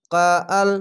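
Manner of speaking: ordinary talk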